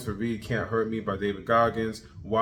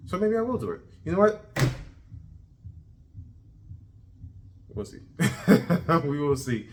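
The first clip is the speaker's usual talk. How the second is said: playful laugh; 7 semitones higher than usual. Real, not acted. Teasing himself with a laugh.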